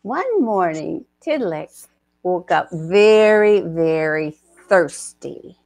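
lively storybook narration; story narration. A narrator's reading. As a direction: tell it like a picture book read aloud.

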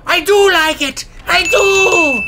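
gasp, then high loud delighted squeal; sudden giddy joy; thrilled squeal. Real, not acted. Thrilled by fast ride.

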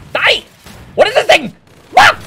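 high, loud panicked gamer yell; screaming. Real, not acted. Gamer screaming at the enemy as he fights, screaming. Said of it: "I love how screaming always makes me play better".

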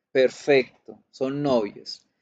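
normal talking voice

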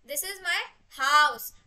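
house held back, then loud and long; pause, then punch "house" slowly; stress on house. Shown as a demonstration. Teacher recapping the third version, stress on house. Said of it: "different versions में explain कर सकते हैं".

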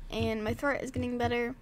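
ordinary talk

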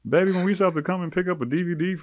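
high-pitched mock amazement; pleasantly surprised. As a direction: imitate a customer surprised by an old memory. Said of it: "maybe they're also surprised".

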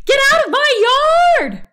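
high, loud furious yell; angry shout. A narrator's reading. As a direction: chase the pig off with your voice. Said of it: "shouted Eugenia".

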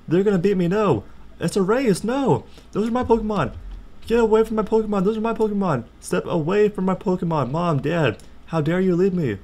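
loud, high panicked shouting; loud high protest at the fleeing family; frantic yelling. Real, not acted. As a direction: yell like you're losing a race. Racing the family to the lab.

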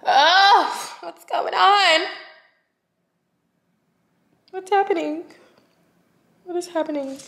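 high, slow confused voice; mock bewildered. Vlogger acting out her closet panic, high and bewildered. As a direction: play lost and overwhelmed. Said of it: "I'm just like".

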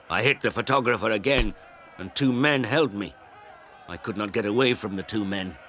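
ordinary delivery

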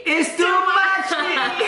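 high, slow mock drunk whine; mock drunk squeal. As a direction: stretch it out high like a tipsy friend. Mocking friend's drunk line.

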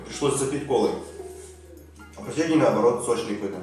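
ordinary delivery

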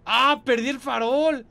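loud, high panicked yelp; alarmed cry. Real, not acted. Gamer losing the lantern, alarmed cry. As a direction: shout as the light goes.

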